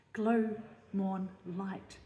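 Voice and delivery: "Glow", "morn", "light" each said full and ringing; fully resonant stressed words. Shown as a demonstration. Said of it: "are fully resonant".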